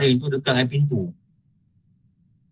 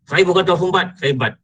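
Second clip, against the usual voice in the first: higher-pitched mock protest; protesting retort; 5 semitones higher than usual. Man objects to being called tahu sumbat, protesting. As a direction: push back at the nickname, voice raised in pitch. Acted retort to a teasing nickname.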